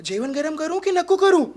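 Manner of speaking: put-on Marathi boy's question. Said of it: "उसने कहा".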